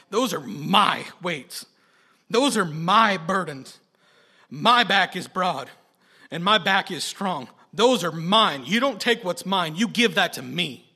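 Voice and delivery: loud, slow scolding, nearing irritation; God. Retold or imitated. God telling a burdened believer to hand it over, stern scolding. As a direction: hammer each claim with forceful, measured authority. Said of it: "nearing irritation".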